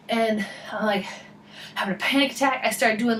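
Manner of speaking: ordinary talk, nothing marked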